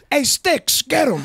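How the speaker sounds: high mock shout to a henchman, laughing; lightly hammed-up Italian American goon calling; mafia thug call. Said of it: "and they go".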